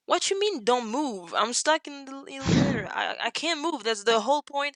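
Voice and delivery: fast, exasperated retelling, pitch jumping up at the start; an incredulous retold complaint with a rushed middle; indignant protest. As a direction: play the outrage of being stuck, half-mocking. Retold protest, stuck in elevator.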